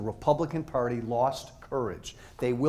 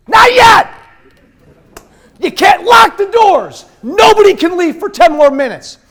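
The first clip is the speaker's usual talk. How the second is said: much higher, much louder joking yell; playful shout at students heading out; mock shout; 17 dB louder than usual. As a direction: bellow it to stop them leaving.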